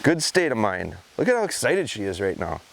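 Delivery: ordinary delivery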